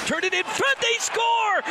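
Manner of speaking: high, loud goal yell; excited goal call. Real, not acted. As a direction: burst out on the goal.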